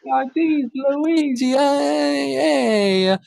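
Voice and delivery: high, hammed-up Italian Mario voice; Mario impression. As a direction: overdo a cartoon Italian plumber accent. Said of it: "pretend to act like Mario".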